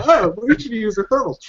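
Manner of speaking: high-pitched mock realization; dim guard. Guard finally remembering the thermals, hammed-up realization. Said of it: "for someone to go".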